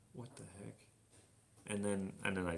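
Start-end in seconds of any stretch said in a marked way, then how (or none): 0.1-0.7 very soft, baffled aside; baffled undertone. Retold or imitated. Retelling an awkward slip to viewers, baffled undertone. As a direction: drop way down, as if asking yourself.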